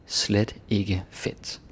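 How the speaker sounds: slow, deliberate Danish phrase; slow example read. Shown as a demonstration. Teacher reading the slide line slowly. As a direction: let each word land slowly.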